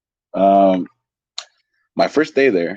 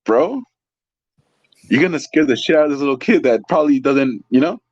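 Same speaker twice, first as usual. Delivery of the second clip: inwardly laughing; 6 semitones higher than usual. Retold or imitated. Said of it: "inside laughing".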